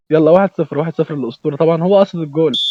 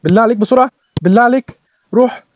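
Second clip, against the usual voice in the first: rising higher and louder toward "جول بقى"; pleading excitement; 7 semitones higher, 3 dB louder than usual. Real, not acted. Streamer begging his striker to score, building high and loud. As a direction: beg him to score, building.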